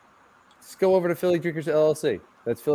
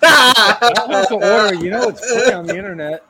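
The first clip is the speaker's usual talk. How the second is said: loud joking retort; 8 semitones higher, 10 dB louder, slower than usual. Real, not acted.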